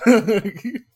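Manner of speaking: laughing through the words. Real, not acted. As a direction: laugh while saying it. Laughing at own joke.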